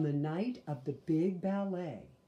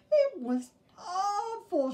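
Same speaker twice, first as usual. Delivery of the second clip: high, slow whimpering wail; child's wail; 7 dB louder, slower than usual. Acted. Sister buries her face in Mama's nightgown, high slow wail. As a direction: stretch it out high like an upset cub. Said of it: "she wailed again".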